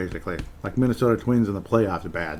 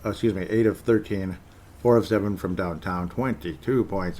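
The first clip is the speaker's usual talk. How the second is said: ordinary delivery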